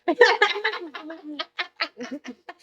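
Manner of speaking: a high, free laugh at her own joke. Real, not acted. Laughing at her own bold joke, high and free. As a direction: let the laugh ring out high.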